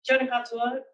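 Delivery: normal talking voice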